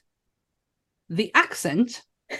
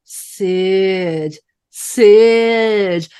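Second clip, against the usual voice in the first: a lightly voiced Nancy calling out Sid's name, second call louder; 5 dB louder than usual. Host imitates Chloe Webb's Nancy calling Sid, second call louder. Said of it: "the way she say it".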